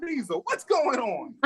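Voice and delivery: high, alarmed mock panic, fading; high comic alarm easing off; panicked. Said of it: "I'm like".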